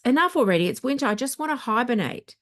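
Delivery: fed up, pitch jumping up; a fed-up outburst at endless emails; exasperated. Real, not acted. Exasperated reaction to spam emails.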